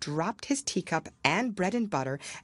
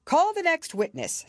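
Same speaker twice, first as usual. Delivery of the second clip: commanding king; 12 semitones higher, 4 dB louder than usual. Acted. King voiced.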